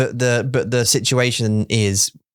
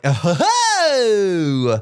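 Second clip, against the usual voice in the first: mock jolly ho ho, slow and high; silly laugh; 7 semitones higher, much slower than usual. Husband showing his silly side, slow mock chortle. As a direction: ham up a silly chuckle. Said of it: "but now I'm like".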